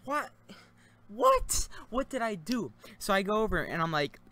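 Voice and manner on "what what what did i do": a sudden high, loud "What?" of disbelief; stunned, as if he can't believe his ears; shocked disbelief. Retold or imitated. Student told he gets detention, shocked disbelief.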